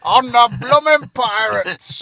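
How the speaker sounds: slow loud pirate roar. Pretend. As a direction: ham it up like a pirate. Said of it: "in a pirate voice".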